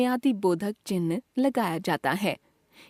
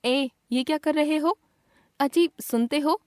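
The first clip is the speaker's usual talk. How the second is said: calling out; 6 semitones higher than usual. Shown as a demonstration. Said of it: "संबोधन बोधक".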